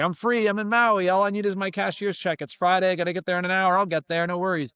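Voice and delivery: high, bright-eyed and pumped up; giddy, carefree burst of joy; carefree excitement. Retold or imitated. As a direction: sell the freedom of just arriving. Carefree hype at landing in Maui.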